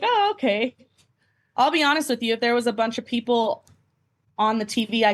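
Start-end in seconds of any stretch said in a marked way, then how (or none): 0.0-0.8 surprised oh. Retold or imitated. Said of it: "I was like".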